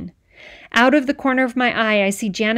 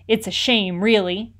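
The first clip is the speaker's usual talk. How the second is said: usual speaking voice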